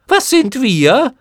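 very high, loud, slow mocking shriek; screaming chorus. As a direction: jeer it back together. Chor fragt. (Mottenchor schreit höhnisch und sehr hoch)

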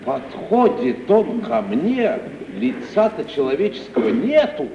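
ordinary talk

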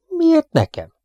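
very high outraged yelp; indignant surprise. A narrator's reading. Theobald to the accuser, indignant and very high. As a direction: protest innocence.

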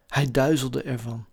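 ordinary delivery, nothing marked

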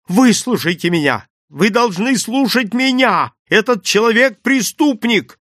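high-pitched desperate insistence; urgent, accusing outcry; full-lunged shout. A narrator's reading. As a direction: loudly demand to be heard. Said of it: "не жалея лёгких".

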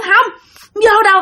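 high, stubborn no; defiant refusal. Retold or imitated. Possessed girl balking at the door, defiant. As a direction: dig your heels in, pitched high.

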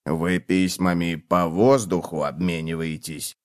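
low, slow cockroach voice, softly admiring. A narrator's reading. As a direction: lightly marvel in a low voice. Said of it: "восхитился таракан".

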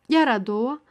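plain reading voice

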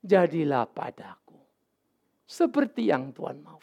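Mary accepting God's will, slow and hushed; Mary's surrender. Retold or imitated.

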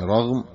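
"رغم" said raghm, with short a after ر; fatha on ra. Shown as a demonstration. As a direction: say "رغم" with an open a on the ر. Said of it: "فهو بفتح الراء".

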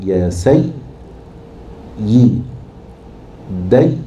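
يا سي ي دي يا in separate pieces with long gaps; split into syllables. Shown as a demonstration. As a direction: break the doubled ya apart, syllable by syllable. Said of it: "الياء الأولى بنفكها اليائين".

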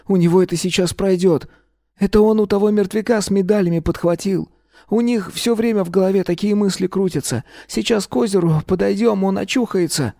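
put-on high young boy voice; a boy explaining eagerly. A narrator's reading. The boy Maloy speaking.

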